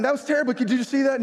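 high-pitched indignant griping; complaining. Retold or imitated. Coach griping about a call to his calm colleague, complaining. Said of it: "I'll complain".

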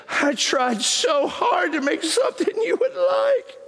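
high, drawn-out mock wail; mock bawling. Husband imitating wife bawling over her cooking, mock wail. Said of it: "She starts bawling".

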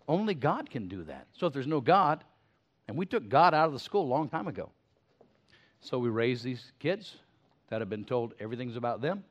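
ordinary delivery

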